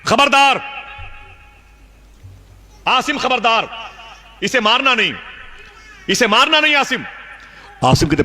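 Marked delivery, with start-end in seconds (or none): 0.0-7.0 high, ringing call of warning; warning voice from nowhere. Retold or imitated. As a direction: call out a stern warning from afar. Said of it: "پھر آواز آئی". (غیبی آواز کی بلند تنبیہ)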